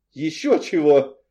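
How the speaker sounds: breezy, drawn-out reply; cheerful. A narrator's reading. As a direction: toss it back merrily. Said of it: "весело ответил мне мужской голос".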